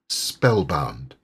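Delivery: ordinary delivery, nothing marked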